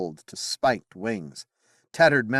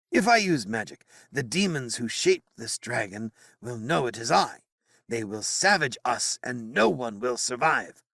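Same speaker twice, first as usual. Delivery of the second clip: despairing wizard; 3 semitones higher than usual. Acted. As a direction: explain the danger in despair. Merlin voiced in narration.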